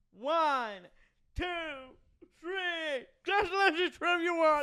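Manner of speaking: loud count building to high celebration; excited count-along. Retold or imitated. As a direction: count the pin out, then cheer. Reenacting counting along with the pin.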